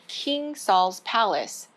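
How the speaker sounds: ordinary delivery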